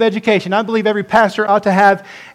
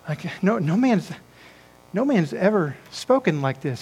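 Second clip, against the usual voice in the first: hushed awe; 8 dB quieter than usual. Retold or imitated. Preacher repeats the awed reply.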